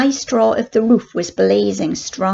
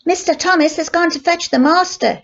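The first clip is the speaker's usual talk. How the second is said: woman shouting; 8 semitones higher than usual. A narrator's reading. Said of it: "shouted a woman's voice".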